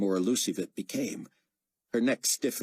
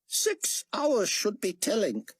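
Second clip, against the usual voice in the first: Chaim voice; slower than usual. Acted.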